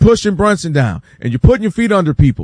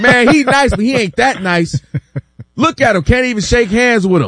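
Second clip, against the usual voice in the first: higher, grudging retold putdown; mock dismissive critic; 6 semitones higher than usual. Doubting fans judging the star's behavior, mock disdain. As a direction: imitate a fan souring on a star. Voicing critics turning on the star.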